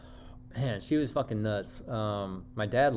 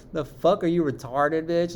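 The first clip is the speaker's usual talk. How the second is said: louder, higher incredulous outburst; exasperated, raised-voice retort; retold exasperation; 6 semitones higher, 5 dB louder than usual. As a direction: throw it out in mock outrage. Acts out exasperation at girlfriend.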